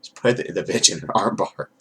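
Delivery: ordinary talk, nothing marked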